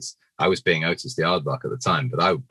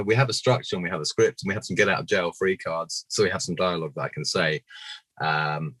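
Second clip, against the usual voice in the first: ordinary talk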